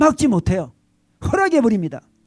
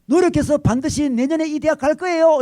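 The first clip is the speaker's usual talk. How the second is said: earnest son; 8 semitones higher, 5 dB louder than usual. Retold or imitated. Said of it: "그때 아들이 이렇게 이야기합니다".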